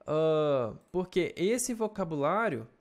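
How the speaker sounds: ordinary delivery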